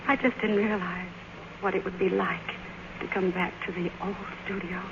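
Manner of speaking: fading to a soft, moved near-whisper; choked up. Acted. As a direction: let the voice sink away, overwhelmed. Moved by returning to studio.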